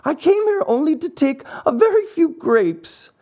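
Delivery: very high puppet wail; acted tearful protest. Puppet pleads innocence to the farmer, high and tearful. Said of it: "sobbing bitterly".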